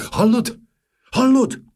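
high, loud alarmed cry; startled, calling out in alarm; panicked repeated question. Acted. King hears the roaring crowd.

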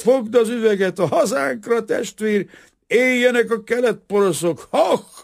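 put-on drunken joy. Drunk carpenter's joyful outburst.

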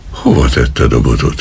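ordinary delivery, nothing marked

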